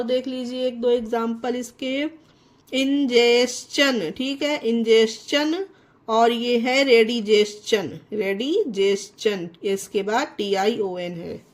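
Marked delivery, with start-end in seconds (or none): 2.7-4.1 very slow इंजेशन, "in-jes-chun"; ch sound for T. Shown as a demonstration. Teacher giving another example, slow इंजेशन with "ch". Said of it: "चा से pronounce". 8.1-9.5 slow, lower रेडिजेशन, "redi-jes-chun"; ch sound for T. Shown as a demonstration. Teacher giving another example, slow रेडिजेशन with "ch". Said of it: "चा से pronounce".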